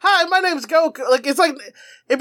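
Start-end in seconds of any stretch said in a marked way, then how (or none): 0.0-1.0 cheery Goku greeting. Retold or imitated. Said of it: "be like".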